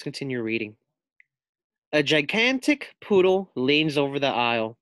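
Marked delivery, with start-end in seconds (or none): none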